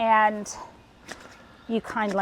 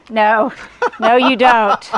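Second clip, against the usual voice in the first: laughing; 7 dB louder, slower than usual. Real, not acted. Laughing at friend's ladder joke.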